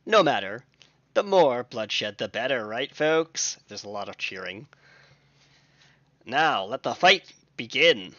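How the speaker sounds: booming ringmaster hype; arena announcer. Acted. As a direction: announce it to a roaring crowd.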